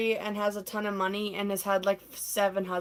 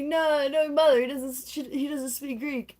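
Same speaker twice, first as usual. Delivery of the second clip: lightly voiced, higher interrupting suitor; Eddie butting in; 6 semitones higher, 4 dB louder than usual. Eddie cuts in as Pepper flirts, lightly mock protesting. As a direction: cut in with a mock protest, pitched up.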